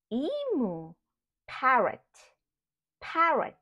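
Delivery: ordinary delivery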